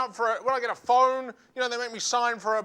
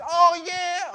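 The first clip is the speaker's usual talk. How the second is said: high, loud eager yeah; junkie's eager yes; 8 semitones higher, 5 dB louder than usual. Retold or imitated. Junkie lying to comedian about a hot meal, loud. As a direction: blurt it like a keen liar.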